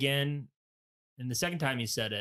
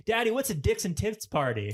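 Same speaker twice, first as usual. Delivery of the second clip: high-pitched mock kid question; hammed-up imitation of a son asking dad; innocent child; 8 semitones higher, 4 dB louder than usual. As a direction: blurt it out like a curious little boy. Voices the son's next question.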